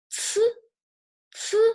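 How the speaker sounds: "ci" high with a strong airy ts; open-teeth ci. Shown as a demonstration. Teacher contrasting it with zi for students, open-teeth ci. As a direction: puff "ci" out with teeth slightly apart. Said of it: "Your teeth are not touching together".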